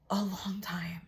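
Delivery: drops to a breathy whisper; a long time, barely whispered. Real, not acted. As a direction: let the words fall to a whisper. Reflective pause in a story.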